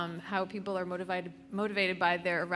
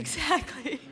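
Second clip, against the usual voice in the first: high laugh through the word; 11 semitones higher, slower than usual. Real, not acted. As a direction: burst out laughing. Laughing at the joke.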